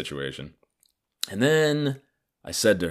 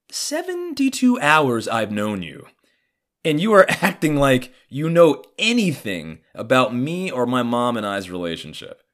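indignant rebuke; 6 semitones higher, 4 dB louder than usual. Retold or imitated. Son to father he barely knows, indignant rebuke. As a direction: draw out the first words in high disbelief.

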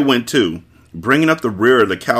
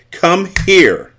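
higher, louder order, snapping on here; snapped command; 9 semitones higher, 4 dB louder than usual. Acted. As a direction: snap out the command. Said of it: "Her finger snapped on "here".

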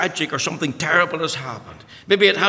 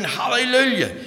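retold shout; 8 semitones higher than usual. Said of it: "he was shouting".

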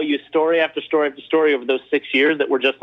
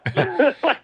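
high burst of laughter; 9 semitones higher than usual. Real, not acted. Laughs at Pete Rose moment.

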